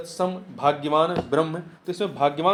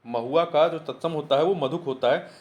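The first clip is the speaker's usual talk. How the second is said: usual speaking voice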